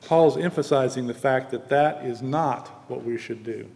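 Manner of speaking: ordinary talk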